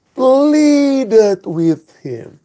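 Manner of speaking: very slow, drawn-out English "pleaded with him"; pleaded slowly. Shown as a demonstration. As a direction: stretch out "pleaded", like a plea. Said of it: "Bahasa Inggrisnya itu lebih tepat". (ucapkan "pleaded with him" sangat lambat dan memohon)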